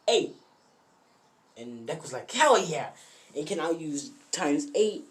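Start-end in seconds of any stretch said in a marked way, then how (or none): 2.3-2.9 loud, high celebration; punchy acted whoop; excited cheer. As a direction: cheer your own power-up. Deku celebrates his new power.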